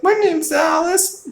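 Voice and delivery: put-on very high, tiny child's voice; a mock little kid answering sweetly; little girl voice. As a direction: squeak it out like a small girl. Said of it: "And the little girl's like".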